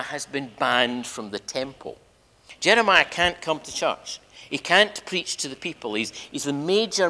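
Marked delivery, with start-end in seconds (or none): none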